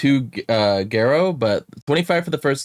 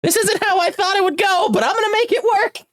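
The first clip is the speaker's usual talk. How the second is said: high squeaky voice. Acted.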